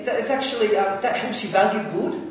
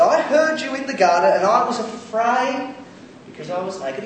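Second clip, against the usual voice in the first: fearful answer; 6 semitones higher, 6 dB louder than usual. Acted. Adam answering God in fear.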